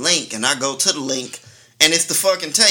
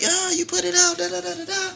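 mocking angry girlfriend. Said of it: "she mad at me like".

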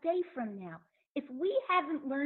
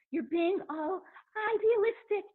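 higher, faster mock gushing; mocking idealism; 8 semitones higher, 4 dB louder than usual. Listener teasing the speaker for idealism, mock gushing. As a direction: parody the listener's eye-rolling at sweet talk. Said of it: "So now you're gonna say to me".

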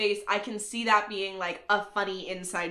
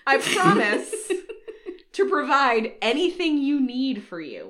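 laughing; 4 semitones higher, 5 dB louder than usual. Real, not acted. Reading vows while cracking up.

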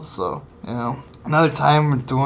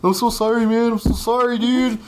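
higher, mock-contrite sorry; sheepish apology; 7 semitones higher than usual. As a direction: play the guilty reservist apologizing over and over. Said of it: "The other half were like".

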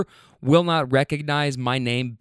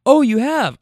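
loud, high-pitched imitated astonishment; mock surprise; 10 semitones higher, 7 dB louder than usual. Rider learning driver has seen the movie, mock surprised. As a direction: jump up high as if amazed.

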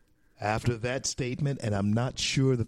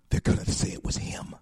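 whispered aside. Real, not acted. Commentator confides a prediction about Prince Philip, whispered aside. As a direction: drop into a whisper.

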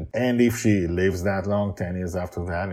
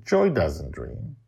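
plain reading voice

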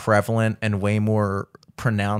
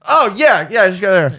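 loud, high-pitched play-acted cluelessness; feigned innocence; 7 semitones higher, 7 dB louder than usual. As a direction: act as if the mistake was accidental. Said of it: "acting like I fucked up".

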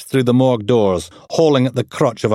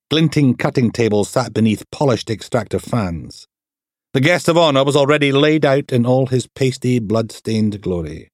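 ordinary delivery, nothing marked

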